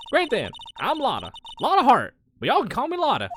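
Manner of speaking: high-pitched, cheery Southern gal; friendly country Southern accent. Acted. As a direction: introduce yourself in a chipper Southern twang. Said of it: "so I could do this accent".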